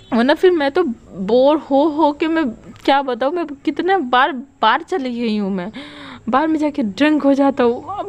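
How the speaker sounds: an exasperated friend unloading; lonely confession. Acted. Wangshi confesses to Wing why she lied, exasperated.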